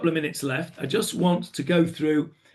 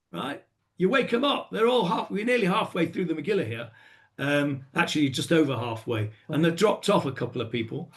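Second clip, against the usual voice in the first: ordinary talk, nothing marked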